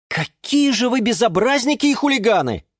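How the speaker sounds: high-pitched indignant scolding. Acted. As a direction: scold the naughty pair. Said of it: "возмутился Юра". (возмущённо)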